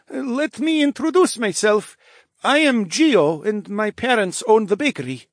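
put-on high, deliberate bear-boy introduction; a high child's character voice introducing himself; young bear boy. A narrator's reading. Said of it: "cleared his throat".

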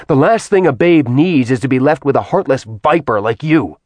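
enraged man cursing the goddess; furious tirade. Acted. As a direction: spit hatred at her, full force. Acheron curses Artemis in rage.

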